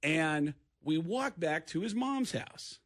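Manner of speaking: ordinary delivery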